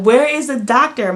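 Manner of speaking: retold loud cry for the doctor; demanding call. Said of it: "I'm like".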